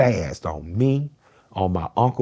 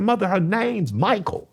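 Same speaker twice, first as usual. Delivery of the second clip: heated; 6 dB louder than usual. Retold or imitated. Heated defense, laughing.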